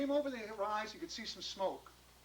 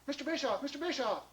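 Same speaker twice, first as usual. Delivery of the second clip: high, excited call; eager calling; 6 semitones higher than usual. Acted. John across the street to Mister Bischoff, eager call. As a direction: hail someone keenly. John calls the next grocer.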